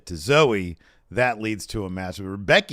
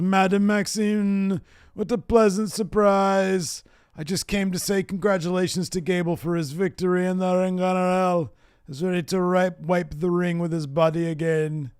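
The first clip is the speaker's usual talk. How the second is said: hammed-up European heel voice; imitating Ludwig Kaiser; 8 semitones higher than usual. Wrestling fan voicing Ludwig taunting Maxine, mock-posh. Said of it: "He says".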